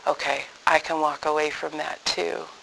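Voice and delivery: soft, hushed words to the Lord; quiet prayer. Retold or imitated. Speaker praying to the Lord, quiet and hushed. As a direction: say it softly, as if praying. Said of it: "I could say to the Lord".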